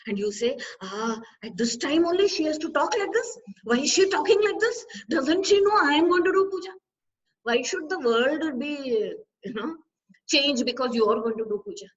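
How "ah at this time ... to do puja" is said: higher-pitched, mock-irritated grumble building in volume; annoyed complaint. Devotee complaining about someone talking just before puja, annoyed. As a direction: act out indignant exasperation, pitch climbing. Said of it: "it is very annoying. It is irritating".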